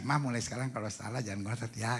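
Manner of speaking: soft, low coaxing; gentle husband. Shown as a demonstration. Modelling a husband's words to his hugged wife, soft and gentle. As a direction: coax her quietly and tenderly.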